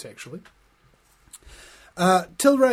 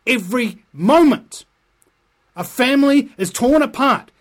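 rising to a louder, higher outcry; getting emotional; 3 semitones higher, 6 dB louder than usual. Real, not acted. Railing at Peter Dunn over cannabis laws, rising outcry. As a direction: pound each line harder against the minister. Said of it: "Sorry I'm getting emotional about this".

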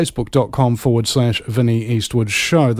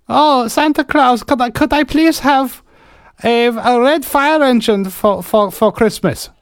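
put-on accent; 11 semitones higher than usual. Someone begging Santa for a fire engine, high put-on accent. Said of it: "I think the accents are about the best".

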